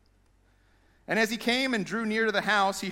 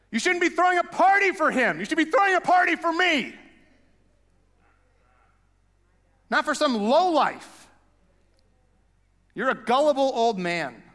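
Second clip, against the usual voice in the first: loud, higher bitter complaint; resentful older brother; 6 semitones higher, 5 dB louder than usual. Retold or imitated. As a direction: throw the accusation at the old man. Older brother ranting at father.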